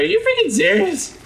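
high incredulous mock voice; annoyed stranger. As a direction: play the fed-up passerby. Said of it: "He's like".

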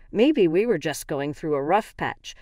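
ordinary delivery, nothing marked

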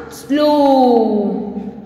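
"Slow" with a very long vowel; pronunciation demo. Shown as a demonstration. Teacher answering how it will pronounce, drawn-out demo. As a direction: stretch "Slow" right out on the o. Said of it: "How it will pronounce?".

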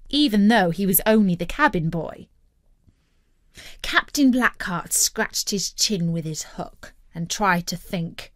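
ordinary delivery, nothing marked

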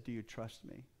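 soft and quiet, a gentle invitation; gentle shepherd's voice. Retold or imitated. Lord asking the speaker in prayer, soft and gentle. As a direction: ask it tenderly, like a shepherd. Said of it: "Gentle shepherd's voice".

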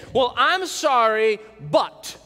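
insincere, drawn-out I'm sorry; mock a grudging apology; fake apology. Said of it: "someone says it like this".

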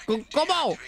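high, frantic questions; panicked squeak. Real, not acted. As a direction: panic over the doors. Panicking at doors.

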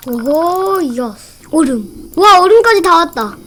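loud, high excited shouting. Real, not acted. Streamer cheers reaching the ice stage, loud excited shouts. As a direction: cheer the progress out.

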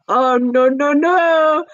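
high, wailing refusal, hammed up; exaggerated high-pitched objection; mock protest. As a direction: draw out each no in a rising mock wail. Mock protest at being treated badly.

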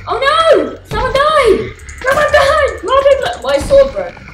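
very high, loud alarm; panicked shrieks. Real, not acted. Panic in game.

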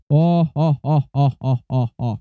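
low, booming giant's laugh, pulsing "oh"; scary giant. Pretend. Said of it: "takutin na lang natin siya". (nananakot na higante)

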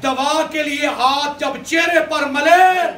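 high, drawn-out oratory; full-force preaching. Real, not acted. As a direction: build the miracle in slow, ringing phrases. (بلند آواز میں جوشیلا خطاب)

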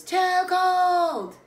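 high, loud, stretched whine; loud complaint. Acted. Child objects to the bath, loudly complaining. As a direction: hold the first word long, high and loud.

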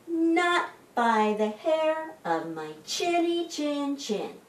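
high, slow piglet voice; little pig's high-pitched defiant refrain; shouting little pig. A narrator's reading. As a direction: refuse in a high, measured piggy voice. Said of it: "The little pig shouted".

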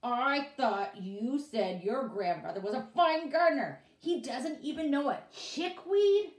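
loud, high angry accusation. Acted. Boss accuses the grandfather, loud angry accusation. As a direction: accuse them of lying, furious. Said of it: "quivered with anger".

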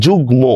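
যুগ্ম as "jug-mo", m fully sounded. Shown as a demonstration. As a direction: say both g and m clearly in যুগ্ম. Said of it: "মফলার উচ্চারণই হচ্ছে".

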